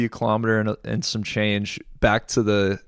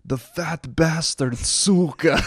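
slow, high mock Ukrainian accent, laughing through it; lightly voiced Ukrainian soldier, amused; 5 semitones higher, much slower than usual. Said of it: "in his Ukrainian accent".

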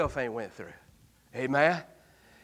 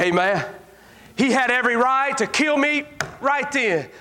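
ordinary talk

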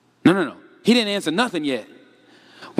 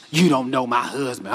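complaining friend; 9 semitones lower than usual. Acted. Acting the complaining friend's reply.

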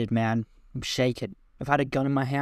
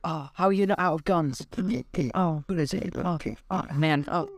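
tag; 5 semitones higher than usual. Acted. Victim being shot repeatedly.

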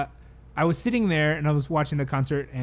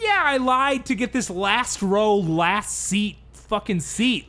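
higher, slow, emphatic comeback; acted indignant retort; 6 semitones higher, much slower than usual. Concertgoer answering a drunk heckler, indignant. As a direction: act out an exasperated sarcastic admission.

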